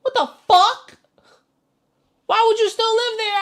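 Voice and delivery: loud high disbelieving what the fuck; real loud shock at the screen; stunned outburst. Real, not acted. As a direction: shout it in pure shock. Reacting to deep snow video.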